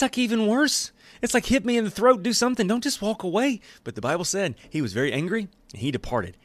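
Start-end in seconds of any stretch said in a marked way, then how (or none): 0.0-3.6 high mock plea. Pleading with someone walking away.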